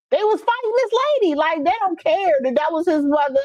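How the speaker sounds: high, fast outrage; outraged. Real, not acted. Telling viewers about kids attacking a mom, high and outraged. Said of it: "outraged retelling".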